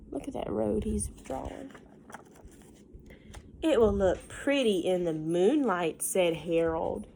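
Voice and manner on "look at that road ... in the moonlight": usual speaking voice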